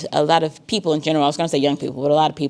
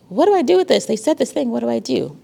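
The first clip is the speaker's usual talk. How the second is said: much higher, fretting student voice; panicked student; 7 semitones higher than usual. Retold or imitated. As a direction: act out the worried student. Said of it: "if you're like".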